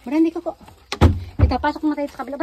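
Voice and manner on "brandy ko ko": loud, high call to a pet; calling the dog. Real, not acted.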